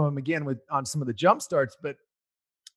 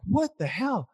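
exasperated; 7 semitones higher, 4 dB quieter, slower than usual. Retold or imitated. Daughter missing, frustrated.

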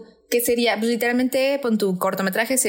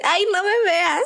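shy Grace; 10 semitones higher than usual. Retold or imitated. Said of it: "y a Grace así de".

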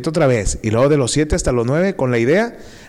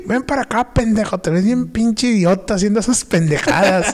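mock angry mother, high and sharp; furious mom; 8 semitones higher than usual. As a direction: bark it like an enraged mother calling her kid over. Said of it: "se me quedó viendo bien feo".

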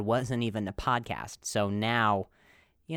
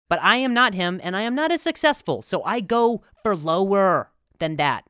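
loud rant; 6 semitones higher, 9 dB louder than usual. Real, not acted. Loud self-deprecating rant.

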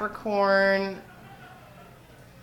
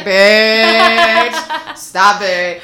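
loud, drawn-out mock scolding; big hammed-up shout of disbelief; imitating exasperated friend; 4 semitones higher, 14 dB louder, much slower than usual. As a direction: yell it like a friend calling you out. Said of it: "my friend would be listening to be like".

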